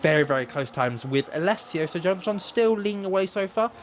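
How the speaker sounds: live play-by-play. Acted. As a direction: race commentator calling laps. Live commentary of race laps.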